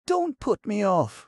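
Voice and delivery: put-on high, pleading young woman. A narrator's reading. Connie begs the gamekeeper not to refuse her, high and pleading. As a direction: beg him not to push her away. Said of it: "she pleaded".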